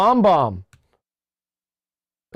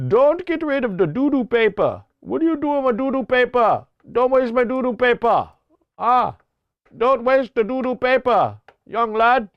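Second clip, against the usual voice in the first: put-on higher, mixed Hawaiian English accent; 6 semitones higher than usual. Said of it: "hybrid Hawaiian English guy".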